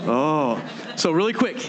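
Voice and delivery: ordinary talk, nothing marked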